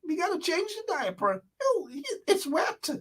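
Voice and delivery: high, soft, fretful mocking voice with a whimper; mock fussing nurse. Mocking health workers in a hospital, high fretful nurse voice. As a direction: parody a panicky nurse cooing over a patient.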